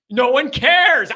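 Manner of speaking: drawn-out exasperated insistence; emphatic repeat. Real, not acted. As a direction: hammer it home. Repeats dismissal, second louder.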